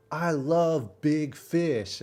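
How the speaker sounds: slow, lightly voiced cook; imitating the cook. Vlogger imitating the cook praising his food, slow mock voice. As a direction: drag out the line like the proud cook. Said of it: "he's like".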